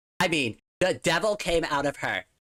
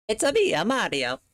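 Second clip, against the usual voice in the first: poor Mario impression; 5 semitones higher than usual. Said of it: "I'm doing a really poor job here".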